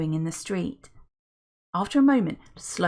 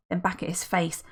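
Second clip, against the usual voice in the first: ordinary delivery, nothing marked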